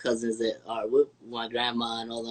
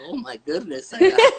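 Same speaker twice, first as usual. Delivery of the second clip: higher, breaking into a laugh on the quote; laughing retelling; 11 semitones higher, 7 dB louder than usual. Retold or imitated. Imitating grandma's reaction to the child's words, laughing. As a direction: quote her astonishment through laughter.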